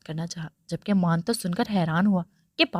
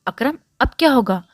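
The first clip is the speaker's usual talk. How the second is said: mildly anxious, higher and louder; worried question; 4 semitones higher, 5 dB louder than usual. A narrator's reading. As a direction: ask with a touch of worry. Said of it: "Shabana बेगम ने फिक्र से पूछा".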